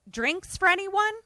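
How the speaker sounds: high-pitched waiter's offer; chirpy waiter. Acted. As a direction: play a waiter, pitched well up. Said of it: "the waiter walks up and is like".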